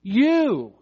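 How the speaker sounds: "You" high, long and falling; emphatic You. Shown as a demonstration. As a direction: punch out "You" as a word on its own. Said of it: "It's there for emphasis".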